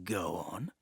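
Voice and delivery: scowling. Acted. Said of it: "Duncan scowled".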